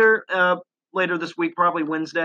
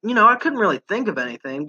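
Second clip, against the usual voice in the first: usual speaking voice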